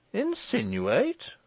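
very high, drawn-out query; mock-surprised echo. A narrator's reading. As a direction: echo it slowly as an incredulous question. Echoes the accusation back.

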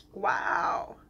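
breathless whispered wow, moved; emotional whisper. Real, not acted. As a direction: let the word slip out in a whisper. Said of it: "I am literally getting emotional".

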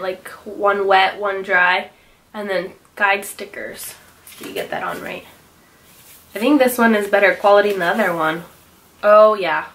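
usual speaking voice

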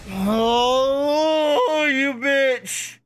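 high wail of loss; anguished cry. Real, not acted. Streamer just missing the gun, high anguished cry. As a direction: cry out as it slips past. Missed the prize by one.

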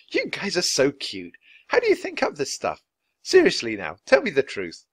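Nancy amused, then pressing for the truth; an adult charmed but insisting. A narrator's reading. Nancy to the children, amused then serious.

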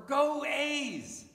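high, loud fan yell; sports cheer. Acted. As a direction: cheer for your team from the stands. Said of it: "We cheer for that a lot".

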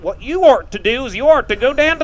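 put-on teasing hill-country accent, folksy advice; mocking hill-country accent. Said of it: "Don't make fun of the way we talk".

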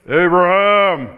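slow, loud commanding call of the name; thunderous divine call; booming call. Shown as a demonstration. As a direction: boom the name out like a voice from heaven. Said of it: "It sounds like this".

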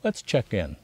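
soft, gentle invitation; retelling the therapist's soft check-in, gently. Retold or imitated. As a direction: ease into it softly like a kind counselor. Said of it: "she said gently".

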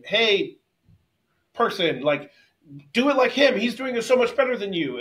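loud, high-pitched heckling parent; imitated yell. Disruptive father criticizing his son from the sidelines, imitated yell. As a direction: badger them in a mock holler. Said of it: "would yell to his son".

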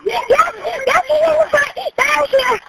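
high, urgent yes-yes; panicked pointing him out; frantic. Real, not acted. Frantic as police arrive.

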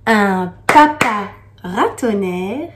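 "papa ratonnerre" read slowly with a lift on "papa"; slow recited "papa ratonnerre"; slow poem reading. Acted. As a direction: let "Un papa ratonnerre," unfold slowly like verse.

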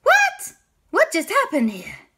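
high, loud, startled cry of disbelief; bewildered gasp of a question; shocked. Acted. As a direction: sound utterly baffled. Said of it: "And they were like".

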